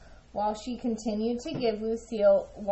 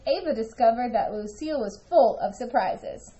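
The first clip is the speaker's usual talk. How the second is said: usual speaking voice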